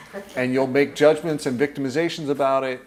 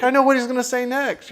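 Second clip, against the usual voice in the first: amused; 9 semitones higher, 7 dB louder than usual. Real, not acted. Amused, quoting own bored self.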